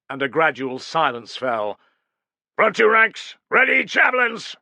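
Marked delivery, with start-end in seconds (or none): 2.5-4.5 high, loud command with stress on two; called order. Acted. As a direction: call the front ranks to ready. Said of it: "called Caedicius".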